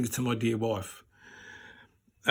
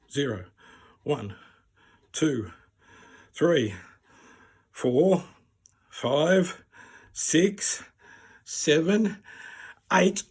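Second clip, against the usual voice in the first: mounting tension; 2 semitones higher, 3 dB louder, much slower than usual. Retold or imitated. Patient silently counting before the tube is pulled, tensing up. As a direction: count from relaxed to braced, pitch creeping up.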